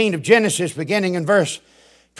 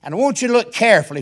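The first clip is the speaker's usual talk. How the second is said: ordinary talk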